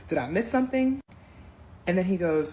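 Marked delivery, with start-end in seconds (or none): 0.1-1.0 high, louder disbelief; can't believe it, asking up high; incredulous. Retold or imitated. Challenging her date, high loud disbelief. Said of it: "I said to him".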